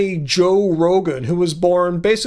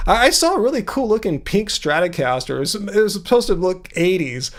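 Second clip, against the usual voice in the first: ordinary talk, nothing marked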